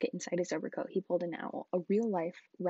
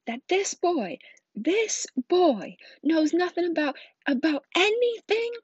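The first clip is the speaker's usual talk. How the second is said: slow, loud roar climbing higher; Hagrid's mounting outrage; 11 semitones higher, 11 dB louder, slower than usual. Acted. Giant confronts the Dursleys over the boy's ignorance, building fury. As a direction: build to an incredulous bellow. Growled rage continues, building.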